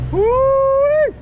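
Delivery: short high cheer; quick yelp of joy; high woo. Real, not acted. Brief cheer.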